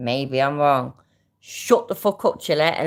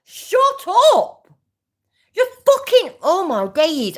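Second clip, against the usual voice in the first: real yell, much higher and louder; furious shout; 7 dB louder than usual. Real, not acted. Fed up with the clip, she screams at the man shut up.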